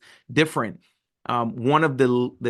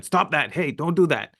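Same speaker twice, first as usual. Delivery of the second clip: mild mock yell at the kids; half-hearted parental shout, higher pitched; retold yelling; 5 semitones higher than usual. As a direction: raise the voice a little, like a parent scolding. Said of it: "even though I'm yelling at them".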